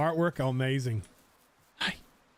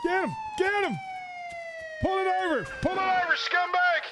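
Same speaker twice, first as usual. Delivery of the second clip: yelling orders mid-fight, pitched way up; frantic shouting; 13 semitones higher than usual. Pretend. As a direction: bellow at attackers in a panic. Shouting during gang attack.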